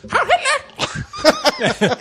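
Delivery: squawked parrot impression. Joking about the pirate's parrot, parrot squawk. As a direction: mimic a little parrot parroting its master. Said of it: "has a little parrot on his shoulder".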